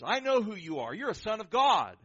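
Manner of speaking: high, very loud outburst; sudden yell. Acted. Somebody interrupts the pastor mid-sermon, sudden yell. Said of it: "somebody suddenly yells out".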